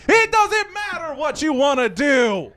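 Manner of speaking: high, loud, drawn-out wrestler shout; bellowed catchphrase. Acted. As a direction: cut them off with a slow, booming catchphrase. Bellowed catchphrase cutting off co-host.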